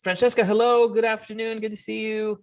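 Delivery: a lightly voiced client meeting a designer; roleplay client greeting. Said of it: "and I say".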